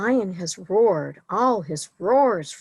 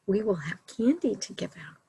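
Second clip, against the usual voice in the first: plain reading voice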